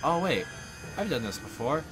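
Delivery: usual speaking voice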